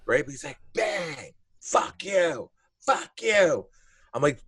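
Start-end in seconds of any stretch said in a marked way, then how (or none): 0.7-3.7 imitated drunk yelling. Drunk man yelling at the window.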